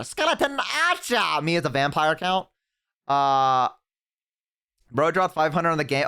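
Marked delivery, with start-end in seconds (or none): 0.0-1.4 much higher playful call; mock hype. Streamer hyping a unit to a friend with a playful high squeal.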